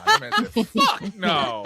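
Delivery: high, loud, cracking up on the quote; laughing retelling. Retold or imitated. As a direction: repeat the punchline through laughter. Repeats funny quote while laughing.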